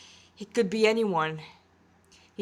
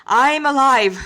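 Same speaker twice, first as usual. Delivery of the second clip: forced insistence; 5 semitones higher, 11 dB louder, slower than usual. Acted. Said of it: "as if he was trying to convince himself".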